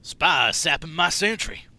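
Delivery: loud, slow Texan call-out; Engineer line. Retold or imitated. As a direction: drawl the warning like the Engineer. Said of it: "Like that".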